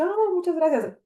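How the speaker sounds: high-pitched retold thank-you; gushing thanks. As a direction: thank them in a high, polite lilt. Retelling own polite thanks.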